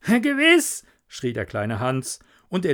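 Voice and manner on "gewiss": eager cry. Acted. Hans cries out eagerly.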